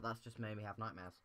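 ordinary delivery

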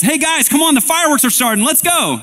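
very high, loud party holler; urgent, thrilled call to the group; excited yell. Acted. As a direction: shout to get everyone moving outside. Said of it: "somebody yells".

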